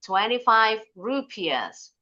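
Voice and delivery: RUPIAHS as "ru-pi-as", h dropped; silent h. Shown as a demonstration. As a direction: say TWENTY-FIVE RUPIAHS slowly, ending in s. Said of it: "H-nya nggak bunyi". (RUPIAHS dibaca "rupias", h tidak berbunyi)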